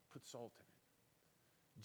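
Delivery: slow whisper, letting it sink in; whispered echo. Acted. Pastor repeating the verse to the congregation, whispered slowly. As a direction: breathe the words out slowly and softly.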